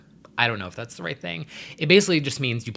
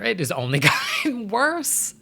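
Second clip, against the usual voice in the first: laughing; 10 semitones higher than usual. Real, not acted. Laughing disbelief at bad news.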